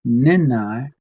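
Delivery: "Nenai" said high and loud, rising at the end; emphatic pronunciation. Shown as a demonstration. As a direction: model "Nenai" with strong emphasis. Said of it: "Emphasize on that".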